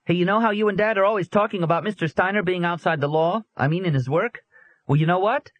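put-on high, eager boy voice; boy telling his absent mom the gossip; excited young boy. A narrator's reading. Boy bursting with news.

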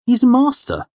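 put-on very high, loud young friend's voice; tactful friend. A narrator's reading. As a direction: counter tactfully in a high-pitched voice. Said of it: "Sim responded tactfully".

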